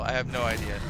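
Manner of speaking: ordinary talk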